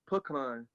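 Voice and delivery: "pecan" as slow PUH-KAHN; weight both halves of "pecan", puh-kahn; both parts stressed. Retold or imitated. Quoting grandpa's country way, slow "puh-kahn" stressed on both parts. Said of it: "put emphasis on both parts of the word".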